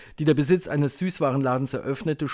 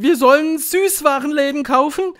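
high, loud, rising disbelief; astonished question; 10 dB louder than usual. A narrator's reading. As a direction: question it like a baffled crowd. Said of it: "japsten sie". (verblüffte Frage, hoch und laut)

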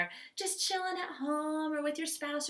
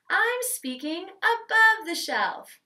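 higher and louder, slow; above the shelf voice; 7 semitones higher, 6 dB louder, slower than usual. Shown as a demonstration. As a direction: lift the voice high above the shelf. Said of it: "you're gonna speak".

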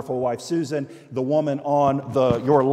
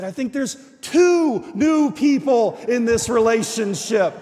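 loud, high declaration; loud proclamation; 7 semitones higher, 6 dB louder than usual. Retold or imitated. Pastor declaring the friend transformed, louder and higher. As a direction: proclaim it with force. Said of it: "And I said".

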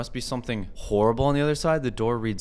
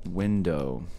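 ordinary talk

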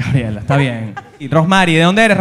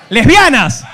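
calling out; 9 semitones higher, much slower than usual. Acted. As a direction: shout it out, climbing higher on the repeat. Comic calls out to the crowd.

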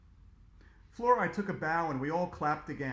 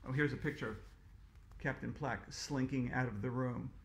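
ordinary delivery

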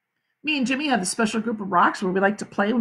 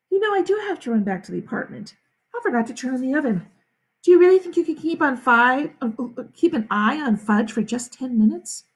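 higher, hurried mom voice; harried mother; 4 semitones higher than usual. Acted. Mother asking Sheila to watch Fudge, higher harried voice. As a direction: think aloud in a rush, pitched up.

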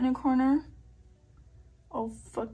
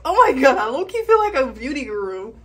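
loud, thrilled beauty guru; excited; 3 semitones higher, 9 dB louder than usual. Real, not acted. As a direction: gush like an influencer.

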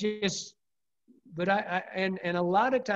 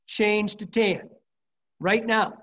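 higher, slow, punched-out order; emphatic urging; 5 semitones higher, 4 dB louder, slower than usual. Retold or imitated. Angler urging his buddy to change flies right now, emphatic. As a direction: press it home, word by word. Urging a fishing partner, retold.

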